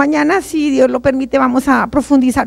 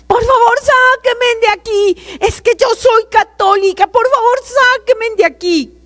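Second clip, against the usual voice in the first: desperate screaming plea; 9 semitones higher than usual. Retold or imitated. Woman begging to be taken out, desperate cry. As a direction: beg to be let out, voice pitched far up. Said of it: "empecé a gritar".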